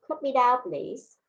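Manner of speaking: usual speaking voice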